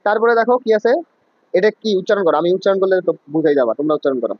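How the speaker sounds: ordinary delivery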